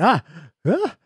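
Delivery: high-pitched startled "ah" of being caught; startled yelp. Acted. Someone spotted crying in your car, startled yelp. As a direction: yelp as if suddenly spotted mid-cry. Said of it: "someone there's like".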